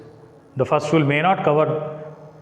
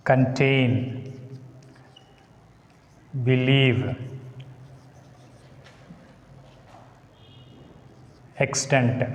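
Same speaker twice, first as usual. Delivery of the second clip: con-TAIN, be-LIEVE, ex-TENT, each said alone; second-syllable stress; 2 semitones lower, 4 dB quieter, slower than usual. Shown as a demonstration. Teacher giving examples of verbs, second syllable stressed in each. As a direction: stress the second syllable of contain, believe, extent. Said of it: "verbs take stress on second syllable".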